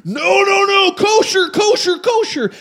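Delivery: high-pitched mock yelling; imitated screaming. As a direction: yell it in a high, frantic imitation. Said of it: "comes yelling at me, screaming".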